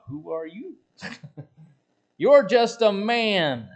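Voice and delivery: mocking, slow, loud jeer; scoffing. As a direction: sneer at a mere man. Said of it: "the aspect would be like".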